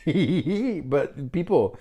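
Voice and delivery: drawn-out laughing "people"; real laugh breaking into speech. Real, not acted. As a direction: laugh through the word, slowly. Laughs mid-sentence.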